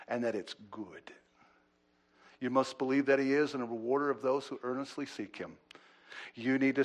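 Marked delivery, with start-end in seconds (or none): 0.1-1.1 whispered, soft and low. Real, not acted. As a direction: drop to a whisper on it. Tender close of a sentence.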